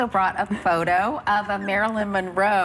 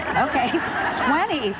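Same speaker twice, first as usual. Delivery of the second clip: laughing; 7 semitones higher than usual. Real, not acted. Laughs at eating twenty.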